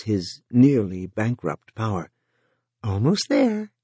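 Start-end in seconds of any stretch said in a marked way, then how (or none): 2.8-3.7 bright upbeat call from above; cheerful encouragement; cheery. A narrator's reading. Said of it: "Pat said cheerily".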